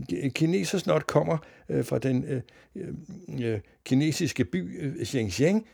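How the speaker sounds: hesitant newsreader. A narrator's reading. Newsreader forced to explain nonsense, halting and puzzled. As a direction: stumble through an explanation on air. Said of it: "forklarede T V-speakeren".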